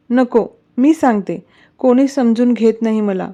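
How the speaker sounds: ordinary delivery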